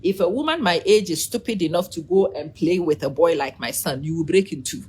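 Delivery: usual speaking voice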